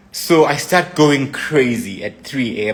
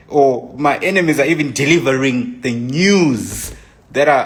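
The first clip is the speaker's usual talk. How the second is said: normal talking voice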